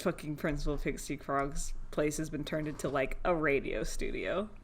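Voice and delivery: ordinary delivery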